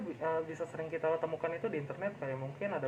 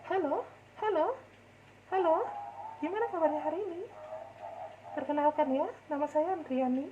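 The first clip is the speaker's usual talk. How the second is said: put-on much higher cute girly voice; girl voice; 13 semitones higher than usual. Shown as a demonstration. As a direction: switch into a high girl voice. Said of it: "Ya, contohnya kayak gitu".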